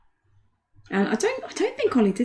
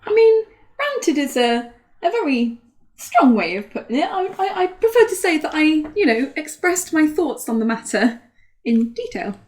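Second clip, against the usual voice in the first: higher-pitched, put-on posh voice; posh accent; 5 semitones higher than usual. Politely rephrasing a rant to a co-host, posh accent. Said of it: "Nice posh accent you got going on there".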